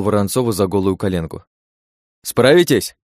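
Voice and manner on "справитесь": loud, high, pressing question; urgent shout. A narrator's reading. Driver asks princess to take over mid-chase, shouting urgently.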